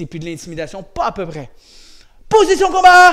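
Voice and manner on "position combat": loud command. Real, not acted. Coach calls fighting stance.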